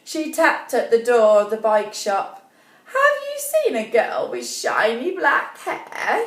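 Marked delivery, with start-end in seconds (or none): 2.9-6.3 put-on old woman voice.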